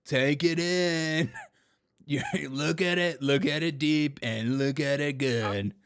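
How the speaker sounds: mock party host. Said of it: "just be like".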